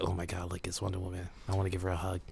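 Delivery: quiet fan gushing. Retold or imitated.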